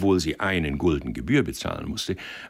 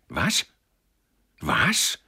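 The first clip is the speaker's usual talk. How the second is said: disbelieving, jumping much higher on the repeat; a stunned what, then a higher one; incredulous outburst; 6 semitones higher, 4 dB louder than usual. A narrator's reading. Boss staring at the clerk's misdeed, incredulous.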